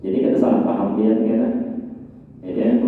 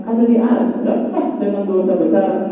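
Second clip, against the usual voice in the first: high, slow questioning "diazab lah"; questioning intonation; 8 semitones higher than usual. Shown as a demonstration. Preacher voicing the hadith in everyday speech, questioning. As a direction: lift "dosa besar" high like a query. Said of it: "intonasinya di-, dibedakan".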